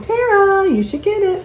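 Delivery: high, chirpy quoted friend; lightly voiced friend urging; quoting Lori. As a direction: echo her high urging. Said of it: "She also found this and like".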